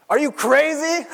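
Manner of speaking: high, loud exasperated mom; frantic mother. Acted. Mary scolding her runaway son, shrill and loud. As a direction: shriek it like a panicked mother. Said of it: "So Mary says to him".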